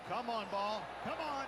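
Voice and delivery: will the ball into the cup; urging the ball. Real, not acted. Urging the putt to drop.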